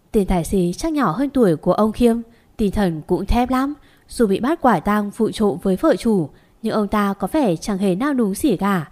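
ordinary delivery, nothing marked

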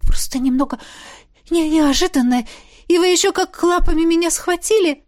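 high, stammering female character voice; high-pitched, shivery and startled; teeth chattering. A narrator's reading. Rescued girl explains herself to the dragon, high shivery stammer. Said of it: "стуча зубами, ответила".

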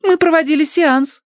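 put-on very high, piping young lady; girlish daughter. Daughter explains the seance to papa, piping.

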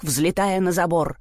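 plain reading voice

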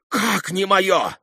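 high, slow, baffled protest; indignant surprise. A narrator's reading. Townsman disputing whose business it is, baffled and indignant. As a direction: protest as if stung. Agitated townsman challenges boy.

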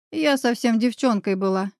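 usual speaking voice

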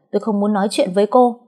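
irritated snap. Acted. Refusing to talk with Thơm, irritated and fast. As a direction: bark it out fast to shut her down. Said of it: "Mình gắt om sòm".

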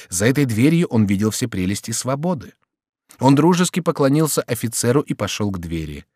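usual speaking voice

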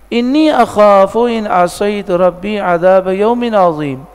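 slow, melodic Arabic recitation; Quranic recitation. Acted. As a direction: chant the verse slowly and reverently.

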